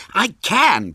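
high, loud contradiction; vehement protest. A narrator's reading. Henry contradicting William about babies, vehement protest. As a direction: protest hard, voice shooting up. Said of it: "protested Henry vehemently".

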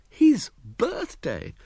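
"His birthday!" high and very slow in mock grievance; "His birthday!" wailed high and slow; aggrieved exclamation. A narrator's reading. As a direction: drag out "His birthday!" like a sulking boy. Echoed grievance, drawn out.

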